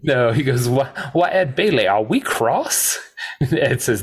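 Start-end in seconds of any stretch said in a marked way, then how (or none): 0.7-3.0 high lilting Southern drawl; mock-innocent Southern taunt, slowing at the end; Doc Holliday. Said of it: "I'm gonna bust out the Southern drawl".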